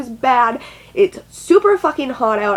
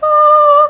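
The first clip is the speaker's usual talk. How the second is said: high loud mock wail; a sharp high sobbing shriek; anguished wail; 11 dB louder than usual. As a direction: let out a despairing cry. Retold wail.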